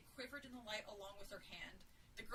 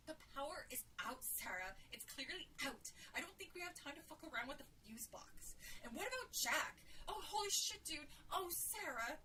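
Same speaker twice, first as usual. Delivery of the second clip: tense, higher voice growing frantic; argue, then tip into frightened wailing; rising panic; 5 semitones higher than usual. A narrator's reading. Jill to Sarah about Jack and the power, rising panic.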